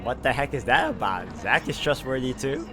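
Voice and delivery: ordinary delivery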